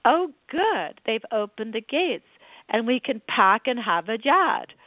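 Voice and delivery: Boston accent lightly put on, dropped R's; light Boston mother voice; mock Boston accent. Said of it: "she says".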